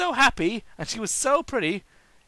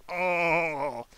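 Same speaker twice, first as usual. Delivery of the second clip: groaning sick; 4 dB louder, much slower than usual. Acted. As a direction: moan out feeling sick. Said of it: "Tails groaned".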